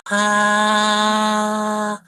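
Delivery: held high "ah" with larynx up. Shown as a demonstration. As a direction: lift the larynx, lighter voice. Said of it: "something more akin to femininity".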